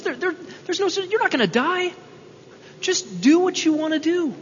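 high, sly tempter voice coaxing her to disobey; coaxing serpent. Acted. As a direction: purr it like a smooth tempting snake. Preacher voicing the tempting serpent.

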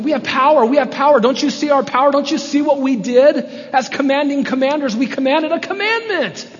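high, loud, hammered-home outrage; angry accusation. Acted. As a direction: rant like officials obsessed with their own power. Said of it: "That's the first angry accusation".

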